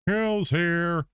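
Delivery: put-on high, loud cracked old-man call; Grandpa voice. Grandpa butts in offering pills, high and loud. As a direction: croak it like a meddling grandpa. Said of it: "You get out of here too, Grandpa".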